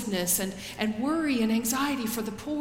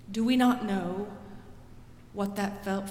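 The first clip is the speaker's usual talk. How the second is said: ordinary talk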